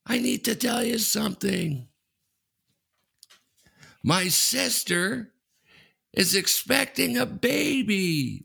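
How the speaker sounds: high, drawn-out mock shout with long gaps; slow, high-pitched calling across water; imitated yell. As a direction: announce it to a distant boat. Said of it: "And he yells out".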